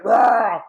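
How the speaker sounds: short loud high roar; one big bear roar. Acted. Reader roars for the kids, loud. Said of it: "Do a roar with me".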